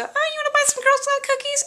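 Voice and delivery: very high-pitched imitation of little girls. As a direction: pipe up like an eager little girl selling. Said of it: "I hear these little girls go".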